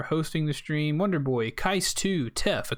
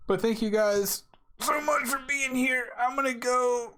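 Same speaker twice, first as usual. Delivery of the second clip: high cheerful sign-off; 10 semitones higher than usual. Real, not acted.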